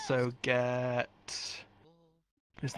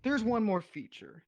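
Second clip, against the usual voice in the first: hard sell ad; 6 semitones higher than usual. Acted. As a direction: sell it like a TV salesman. Improv infomercial pitch.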